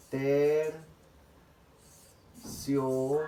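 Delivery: "terciopelo" hugely drawn out, syllable by syllable, "pe" stressed; graded syllable stress. Shown as a demonstration. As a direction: stretch each syllable of "terciopelo" very long, lifting "pe". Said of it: "Significa que he pronunciado de esta manera". ("terciopelo" muy alargado, con alza de voz en "pe")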